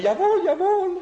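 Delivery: high, loud, eager German yes; hammed-up eager compliance; eager jawohl. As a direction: snap to it with a laugh. Camp chief eagerly complies.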